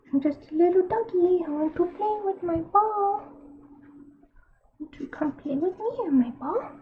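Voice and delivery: high, squeaky little puppy voice; cartoon dog. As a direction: play a cute tiny doggy. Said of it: "That's what this chibi says".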